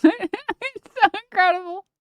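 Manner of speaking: high, squeaky laughing take on the same line; laughing disbelief. Shown as a demonstration. Streamer laughing in disbelief at the clip, high and giggly. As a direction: say it through laughter, pitch jumped up. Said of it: "This is a filter I can't tell".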